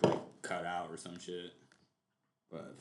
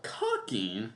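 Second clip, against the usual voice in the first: "cucking" drawn out slowly, rising like a question; mimicked questioning emphasis. Streamer mocking how the video's host said it, questioning emphasis. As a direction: stretch out "cucking" with a questioning lift. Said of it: "why did you say it like that".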